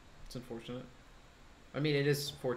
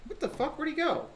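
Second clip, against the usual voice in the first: very high startled outburst; surprised; 7 semitones higher than usual. Real, not acted. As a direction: react in shock to the kill. Enemy vanishes, then dies.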